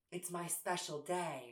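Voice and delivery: soft, slow, deadpan delivery; flat deadpan. Shown as a demonstration. Contrasting Jimbo's big delivery with a soft, slow, deadpan version. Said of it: "if he just went".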